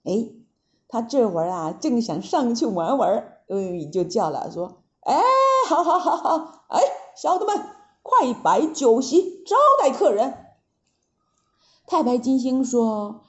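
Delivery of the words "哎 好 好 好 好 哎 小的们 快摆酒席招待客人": high, chuckling delighted monkey king; laughing Monkey King. Acted. Monkey King welcoming the envoy, laughing with glee. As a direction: laugh and order the feast.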